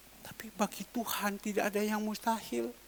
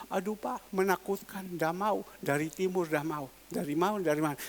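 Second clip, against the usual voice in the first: usual speaking voice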